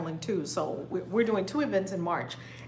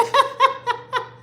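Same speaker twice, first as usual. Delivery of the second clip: loud, high burst of laughter; high delighted laugh; 7 dB louder than usual. Real, not acted. Laughing at a viewer's comment, high and loud. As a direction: let out a squealing laugh.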